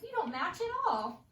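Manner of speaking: lightly playing mom at the foot of the stairs; mom scolding. Teacher voicing a mom telling a child their outfit clashes, mild scold. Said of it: "Mom might say".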